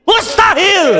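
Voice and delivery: "Mustahil" shouted high and short; emphatic shout. Acted. Preacher answering the question, shout. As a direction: bark the answer out.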